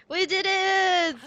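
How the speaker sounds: loud, high, drawn-out whoop; elated holler, slow and big; triumphant cheer. Real, not acted. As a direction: stretch the last word in victory. Celebrating completing the last beast.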